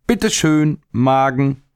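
high, bright teasing call; boy cheekily offers the plum to his stomach, high teasing call. Acted. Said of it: "rief er".

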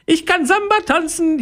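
very high, gleeful cheer; jubilant. A narrator's reading. Pauline finally managing the samba steps, jubilant cheer. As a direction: squeal it in triumph. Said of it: "jubelt Pauline".

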